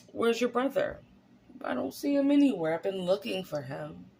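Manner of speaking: high, searching question voice; voicing God. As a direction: ask like a puzzled God looking around.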